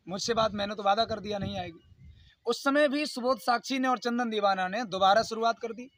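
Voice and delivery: ordinary talk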